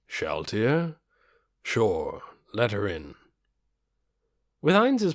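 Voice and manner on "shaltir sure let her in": a calm dark lord thanking his servant; undead lord voice. A narrator's reading. Ainz voiced in story.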